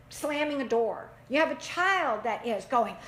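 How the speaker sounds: ordinary delivery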